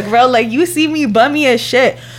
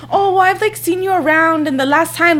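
higher-pitched mimicry of the neighbor; mocking imitation; 5 semitones higher than usual. Imitating the neighbor bringing up past encounters, mocking. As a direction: put on her voice to make fun of it.

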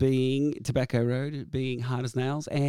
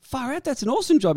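excited; 9 semitones higher than usual. Retold or imitated. Retold excited reply.

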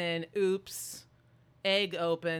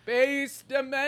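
mock echo; 9 semitones higher, 8 dB louder than usual. Echoing partner in mock voice.

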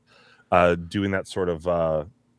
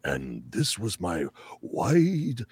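put-on dramatic wise old wizard, hushed; 5 dB quieter than usual. Shown as a demonstration. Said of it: "my wise old wizard voice".

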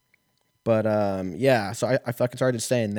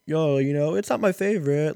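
much higher, louder casual server; mock honest server; 7 semitones higher, 4 dB louder than usual. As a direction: play a blunt laid-back waiter. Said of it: "some servers are like".

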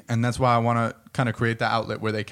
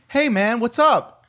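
higher, louder mock friendly stranger; overfriendly Californian; 9 semitones higher, 5 dB louder, slower than usual. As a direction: imitate a chirpy Cali passerby. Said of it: "random people just like".

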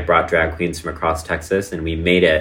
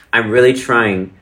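normal talking voice

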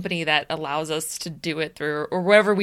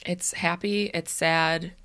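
ordinary talk, nothing marked

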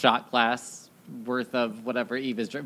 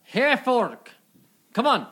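calling out; 11 semitones higher, 5 dB louder than usual. Acted. Calling a friend to come.

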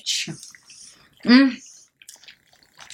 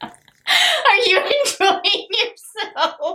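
high, slow laughing question; 10 semitones higher, much slower than usual. Real, not acted. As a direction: squeeze it out laughing. Laughing fit.

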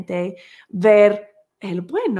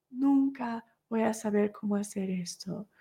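resigned repeat; 8 dB quieter than usual. Retold or imitated. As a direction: let the despair sink into a soft murmur. Mother repeats the thought, fading. (repetición resignada, suave y baja)

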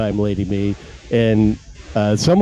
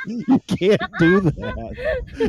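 high, slow, laughing disbelief; laughing you can't say that; laughing protest; slower than usual. Real, not acted.

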